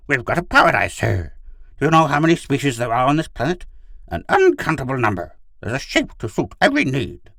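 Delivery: high, loud dog character; enthusiastic talking dog. Acted. Gur invites Pid to join, enthusiastic dog.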